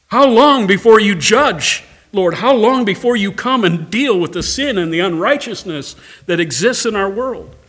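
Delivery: loud, impassioned lament; preacher voicing a congregation's cry; crying out to God. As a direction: pour out grief at the world's evil. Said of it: "we can say the same thing".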